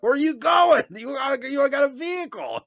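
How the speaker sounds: high surprised call; mock surprised. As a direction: call after him in disbelief. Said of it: "I'm like".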